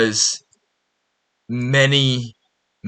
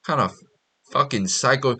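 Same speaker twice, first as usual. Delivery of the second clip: a touch of stunned mock outrage; mock disbelief; 2 semitones higher than usual. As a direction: react like she's crazy, pitched up. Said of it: "And you're like".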